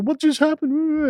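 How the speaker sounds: high, drawn-out mock wail. Banned users complaining to each other on Threads, mock wailing.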